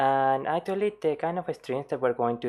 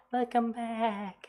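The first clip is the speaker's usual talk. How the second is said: much higher, very slow welcome back; head voice; 12 semitones higher, much slower than usual. Shown as a demonstration. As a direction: float it up near whistle register. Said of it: "much more head resonance ... whistle register".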